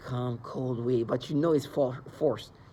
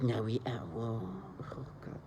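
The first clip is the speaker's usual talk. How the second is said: mock hypnotic taunt; 4 semitones lower, 10 dB quieter than usual. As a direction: lightly imitate the harassers' fake dreamy calm. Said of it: "And like".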